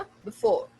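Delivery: बिफोर with reduced first vowel; बिफोर, quick neutral buh; quick schwa. Shown as a demonstration. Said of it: "मैंने ना बी कहा है".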